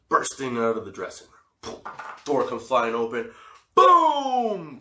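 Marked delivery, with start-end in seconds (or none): 3.7-4.8 loud, high, drawn-out boom; big booming burst sliding downward; explosion boom. Retold or imitated. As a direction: imitate a door bursting open like an explosion. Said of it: "Kinda like an explosion".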